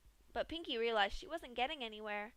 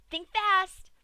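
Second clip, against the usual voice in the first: much higher, much louder cheery call; piping up; 10 semitones higher, 14 dB louder than usual. A narrator's reading. Pinkie calling to Dash before yanking, high and loud. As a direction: pipe up like a game. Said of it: "Pinkie piped up".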